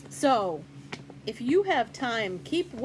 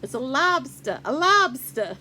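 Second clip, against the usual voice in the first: a failed Maine accent on "lobster", laughing; attempted Maine accent; 8 dB louder than usual. Shown as a demonstration. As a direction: giggly try at a Maine "lobster" dropping the r. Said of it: "I can't even say it".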